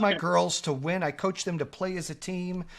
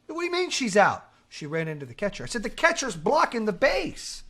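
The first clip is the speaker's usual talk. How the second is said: worked-up retold argument, raised pitch; heated protest; 5 semitones higher, 3 dB louder than usual. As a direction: argue the call, pushing higher at the end. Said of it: "You need to tone it down".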